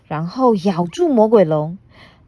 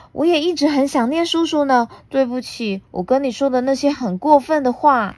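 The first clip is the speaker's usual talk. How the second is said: higher, fast, then sorry; small girl apologizing; 7 semitones higher than usual. Acted. Puna apologizing to her uncle, sweet and sorry.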